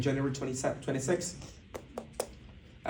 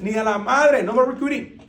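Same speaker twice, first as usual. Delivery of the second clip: raised, higher exasperated cry; exasperated outburst; 7 semitones higher, 9 dB louder than usual. Retold or imitated. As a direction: blurt it out like you're done with it all. Fed-up outburst about quitting team.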